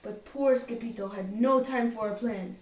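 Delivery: plain reading voice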